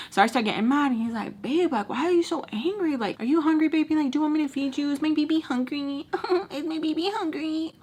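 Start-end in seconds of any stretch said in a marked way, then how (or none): 1.4-7.7 put-on high, cooing, patronising boyfriend voice; mock baby talk. Boyfriend asking his angry girlfriend if she's hungry, high baby talk. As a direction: coo at her like she's a baby.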